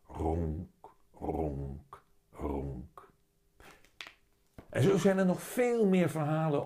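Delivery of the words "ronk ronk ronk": low, soft purr fading to a whisper; cat purring. A narrator's reading. The only sound as Willem plays, soft fading purr. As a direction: let the purrs sink quieter and lower. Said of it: "het enige wat je hoort".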